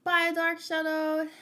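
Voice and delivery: cheerful bye. Real, not acted. Waving off a departing viewer.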